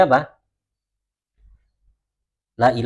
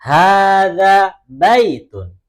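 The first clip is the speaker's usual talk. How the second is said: slow, loud, drawn-out Arabic, every syllable of "Hada masjidun" stretched; slow model reading; 5 semitones higher, 6 dB louder, much slower than usual. Shown as a demonstration. Teacher modelling Arabic for the class, slow loud recitation. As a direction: chant it slowly for the class to repeat. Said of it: "kita baca bersama-sama".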